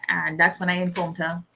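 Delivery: ordinary talk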